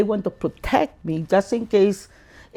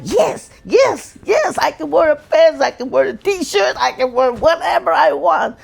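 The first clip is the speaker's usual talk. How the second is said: secret glee; 6 semitones higher than usual. Retold or imitated. Inner joy at clothes burned.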